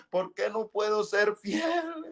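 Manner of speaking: retold slow anguished plea. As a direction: drag it out, begging, on fiel. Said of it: "gritaba desesperado".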